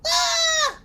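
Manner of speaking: very high imitation squeal; high-pitched wordless mock cry; mock crowd squeal. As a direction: squeal out like an audience member. Acting out crowd shouting answers.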